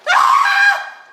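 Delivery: loud, high shriek of fright; big reenacted scared scream; startled scream. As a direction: scream as if caught by surprise. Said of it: "And we're like".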